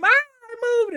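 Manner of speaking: high, loud startled shriek, played for laughs; mock scream. Mocking TV ghost hunters who flee, mock scream. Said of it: "like, scream".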